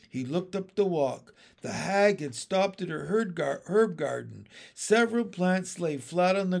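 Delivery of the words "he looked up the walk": usual speaking voice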